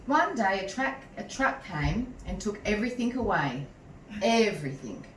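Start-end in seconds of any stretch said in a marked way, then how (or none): none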